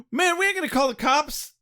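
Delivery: high-pitched agitated protest, acted; imitated freaking out. Friend refusing to call the cops, acted high-pitched freak-out. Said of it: "the one that's freaking out is like".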